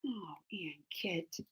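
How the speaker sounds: hushed, breathy whisper of a name. Real, not acted. Streamer whispering a viewer's name while reading chat. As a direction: whisper the name to yourself, slowly.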